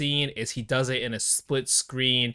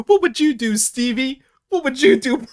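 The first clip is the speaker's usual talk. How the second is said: much higher and louder, hammed-up Denzel plea; mock pleading, pitched way up; Denzel impression; 10 semitones higher, 6 dB louder than usual. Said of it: "him doing the".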